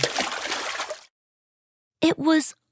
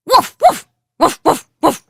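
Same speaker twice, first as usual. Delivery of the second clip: barking dogs; 6 semitones higher, 7 dB louder, faster than usual. Acted. Dogs bark and leave.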